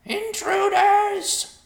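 very high, drawn-out vulture shriek; loud alarm yell. A narrator's reading. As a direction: raise the alarm across the bog. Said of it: "called loudly into the air".

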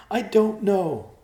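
high, loud, drawn-out cry; loud, helpless high wail of words; distraught. A narrator's reading. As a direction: answer slowly in high-pitched distress. Distraught sister answers her brother.

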